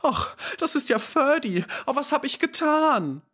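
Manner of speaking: put-on high-pitched anguished cry of a young woman; shrill outcry. Letty cries out seeing dying Ferdy, shrill and high. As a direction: shriek it out in horror and remorse. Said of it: "ihren schrillen Aufschrei".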